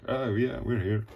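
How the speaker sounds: high, loud cheerful announcement; excited arrival. Retold or imitated. Ferryman announces arrival to the hero, excitedly. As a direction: call out the arrival gladly. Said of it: "And like".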